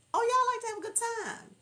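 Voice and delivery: high, loud playful squeal; high-pitched delighted outburst; gleeful exclamation. Real, not acted.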